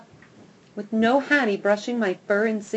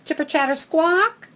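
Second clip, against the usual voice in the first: squirrel chatter; 8 semitones higher, 4 dB louder than usual. A narrator's reading. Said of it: "my ears perk in annoyance".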